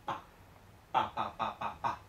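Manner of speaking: PA repeated with an unaspirated p; short PA syllables in a row, no puff; Chinese pinyin b. Shown as a demonstration. Teacher drills the correct reading of B, unaspirated PA. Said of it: "se pronuncia pa".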